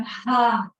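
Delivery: loud breathy "ha" sigh; audible sighing exhale. Shown as a demonstration. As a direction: let the breath go in a big sigh. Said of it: "sighing the breath out".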